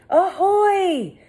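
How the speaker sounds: high hail from afar; calling ahoy. A narrator's reading. Rescuer calling across the beach. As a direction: hail them loudly. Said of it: "someone called".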